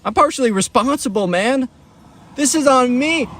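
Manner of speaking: high-pitched mock anguish; guilty panic. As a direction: wail that it is all your fault.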